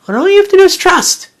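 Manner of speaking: rising preacher's cry of trust; emphatic and high. Real, not acted. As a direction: lift the whole line high and urgent.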